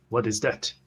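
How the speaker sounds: usual speaking voice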